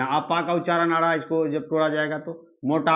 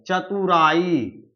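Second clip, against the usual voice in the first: चतुराई stretched very slowly, syllable by syllable; teacher slowly sounding out चतुराई; slowly drawn out example; much slower than usual. Shown as a demonstration. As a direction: lay out चतुराई slowly for the class. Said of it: "teacher drawing out an example word".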